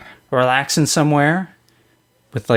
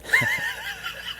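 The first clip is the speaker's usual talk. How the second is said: high, squealing performed laugh; over-the-top laugh; 11 dB quieter than usual. Pretend. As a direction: fake a big goofy chuckle on request. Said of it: "that was not a chuckle".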